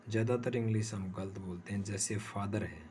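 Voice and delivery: ordinary talk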